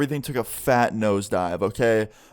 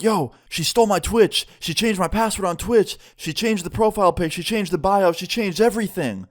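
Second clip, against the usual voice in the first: retold panic; 7 semitones higher than usual. Friend tells narrator his ex hijacked his Twitch, panicked and high. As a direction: rattle it off in alarm, pitched up. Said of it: "He's like".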